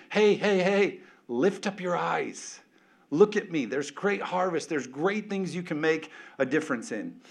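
grab their attention and point to the harvest; urgent preaching call. Acted. God calling out.

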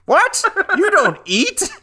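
high incredulous squeak, laughing; mock shocked. Friend joins the butler bit with a high what. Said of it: "he was like".

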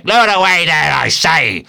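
high, loud insistent command; captain shouting to be obeyed; thundering shout. A narrator's reading. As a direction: thunder the order across the deck. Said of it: "Such was the thunder of his voice".

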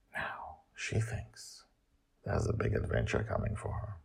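soft hushed aside; whisper. Real, not acted. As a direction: whisper it confidentially. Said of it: "drops to whisper".

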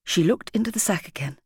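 ordinary delivery

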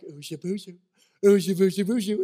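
playful sing-song cooing of "shaboozhoo"; baby talk. Pretend. Parent playing with a baby, cooing baby talk. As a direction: coo silly nonsense to make a baby laugh.

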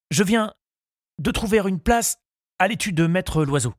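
high-pitched, halting suitor's voice; flustered young suitor. A narrator's reading. Charles tells Henriette about his new position, flustered. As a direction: announce it high, in nervous little bursts. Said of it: "Charles se troublait".

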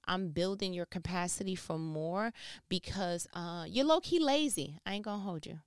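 ordinary talk, nothing marked

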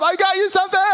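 hammed-up high squeal of delight; faked enthusiasm. Husband overdoing his role for his wife, gushingly. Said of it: "I doubled down on the role".

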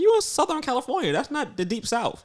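high-pitched mock disbelief; retold incredulous retort. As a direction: retell your baffled reply, pitched high. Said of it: "I'm like".

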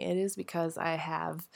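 stuffed-up sick voice; cold voice. Real, not acted. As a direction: speak through a raging cold. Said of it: "a raging cold".

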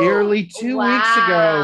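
slow, loud overacted reading; dramatic shout. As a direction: boom it out slowly like a trailer.

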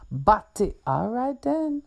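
sneering slur, pitched high; mocking taunt. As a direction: spit the insult, then jeer. Donovan taunts Daisy.

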